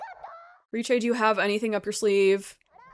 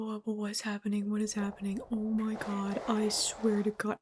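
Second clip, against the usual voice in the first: whisper; 4 semitones lower, 7 dB quieter, faster than usual. Real, not acted. Whispering in shock at scene.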